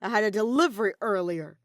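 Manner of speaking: loud, gloating triumph; triumphant. A narrator's reading. Kaito gloats to his captor, loud and triumphant. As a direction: announce it like a winning card. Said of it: "Kaito told him triumphantly".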